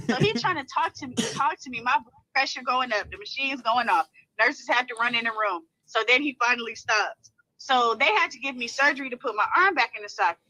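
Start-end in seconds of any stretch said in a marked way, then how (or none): none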